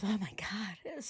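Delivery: whispered mock awe; hushed, breathless exclamation; breathy whisper. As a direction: gasp it out under breath. Imitates her breathless gasp.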